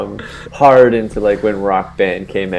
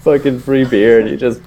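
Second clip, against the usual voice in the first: usual speaking voice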